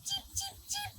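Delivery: very high, tiny cheeps; high soft chick cheeps. Acted. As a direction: peep it like a baby chick. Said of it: "Open your beak and sing".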